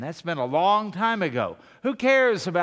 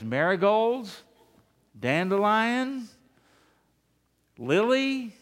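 drawn-out rising guesses on Marigolds, Dandelion, Lily; tentative guessing; 4 semitones higher, slower than usual. Acted. Joke: friend guesses flower names.

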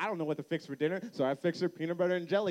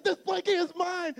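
high-pitched, childish mock triumph; mad dad; 10 semitones higher, 3 dB louder than usual. Dad seizes daughter's blankie, retold angry claim. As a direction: act out a father in a petty rage. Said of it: "I got so mad".

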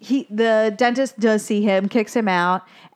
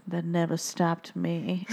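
low, slow, hushed dramatic reveal; hammed-up solemn gravity, soft and drawn out; 4 semitones lower, 8 dB quieter than usual. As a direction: deliver it like a grave movie line. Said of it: "very dramatically, he's like".

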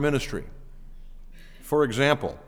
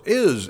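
"Is" said alone, high and loud; single stressed "Is" for the argument; emphatic is; 4 semitones higher, 5 dB louder than usual. Shown as a demonstration. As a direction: lean on "Is" as the key word. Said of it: "would be their argument".

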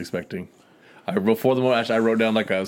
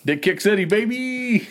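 hyped shout; 11 semitones higher than usual. Real, not acted.

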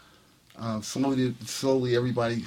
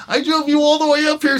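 loud, high-pitched retold scolding; exasperated insistence; 14 semitones higher, 9 dB louder than usual. Friend to balking musician at the club door, loudly exasperated. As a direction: imitate a friend laying down the law.

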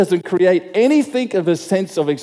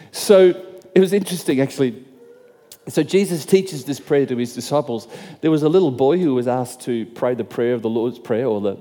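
ordinary talk, nothing marked

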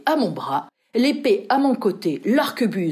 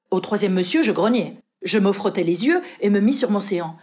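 ordinary delivery, nothing marked

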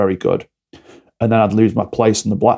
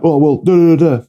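mocking child babble; 6 semitones higher, 5 dB louder than usual. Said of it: "I'm like".

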